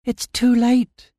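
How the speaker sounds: high-pitched, slow, drawn-out dread; alarmed despair. A narrator's reading. Amira sees the storm coming, alarmed despair. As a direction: draw out the realisation, pitch high. Girl sees the funnel descending.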